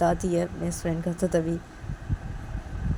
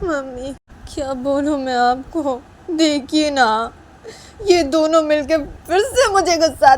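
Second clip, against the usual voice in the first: high, loud, aggrieved complaining; exasperated Manisha; 9 semitones higher, 8 dB louder than usual. Acted. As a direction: pour out grievances to mom, pitched up and loud.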